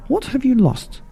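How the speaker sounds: high, bright frog lady asking; high-pitched shopkeeper question; Missus Frog voice. Acted. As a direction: ask it up high, friendly. Missus Frog asks mouse.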